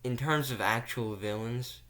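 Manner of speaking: usual speaking voice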